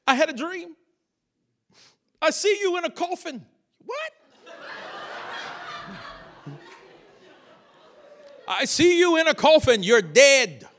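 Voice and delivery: high-pitched doom-laden warning, acted out; alarmed prophet. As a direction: announce a dire vision, hammed-up. Acts out prophetic person's alarming dream.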